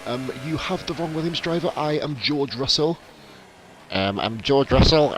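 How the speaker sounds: lightly voiced entitled rich kid; George Russell impression. Streamer corrects a viewer as a lightly voiced George Russell. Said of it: "worst George Russell impersonation".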